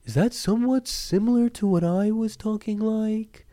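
odd character voice sliding upward; different put-on voice. Shown as a demonstration. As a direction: start in a put-on voice, then lift it high. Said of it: "if I talk like this".

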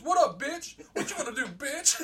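very high mock challenge; cocky taunt. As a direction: goad the monster with comic bravado. Friend taunting monster, retold.

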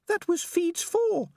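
very high, slow, shocked rat; Peaches the rat. A narrator's reading. Rat turning to her friend, high and shocked. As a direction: say it slowly in a tiny high voice.

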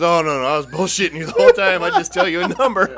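loud, high, offhand mom voice; mocking mom casually admitting the lie, loud and high; mom's breezy confession. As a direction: brush it off like it's nothing. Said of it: "She goes".

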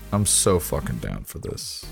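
ordinary talk, nothing marked

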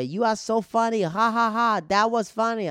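high, loud mock-aggressive compliment with forced laugh; aggressive praise. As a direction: shout the praise with a fake ha ha. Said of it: "in an, an aggressive way".

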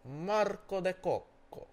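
high, loud, slow fake Italian name; drawn-out mock Italian. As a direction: stretch every syllable in hammed-up Italian. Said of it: "One more time?".

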